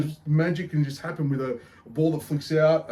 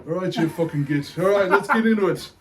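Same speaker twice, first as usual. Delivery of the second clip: put-on Irish accent; 5 semitones higher than usual. Beer show host greets punters, hammed-up Irish accent. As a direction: put on a cheeky Irish brogue. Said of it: "I'll go back to normal talk here".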